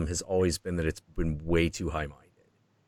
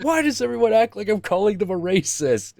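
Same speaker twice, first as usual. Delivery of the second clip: defensive whine; 14 semitones higher, 6 dB louder than usual. Retold or imitated. Marx voiced, defensive.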